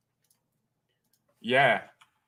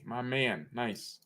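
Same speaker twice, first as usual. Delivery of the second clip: ordinary talk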